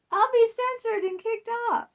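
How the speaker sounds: high, loud exaggerated worry; mock alarm. Real, not acted. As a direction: overplay the fear of being banned. Mock alarm about censorship.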